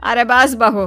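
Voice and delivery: ordinary delivery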